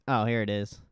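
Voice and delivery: normal talking voice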